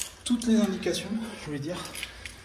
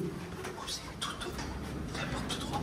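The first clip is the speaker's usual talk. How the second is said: library whisper; 10 dB quieter, slower than usual. Real, not acted. Chuchotent dans le couloir.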